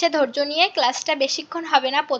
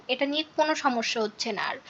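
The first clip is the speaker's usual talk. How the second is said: ordinary talk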